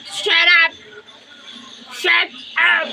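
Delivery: playful shut up. Pretend. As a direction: snap at the rooster. Telling rooster off.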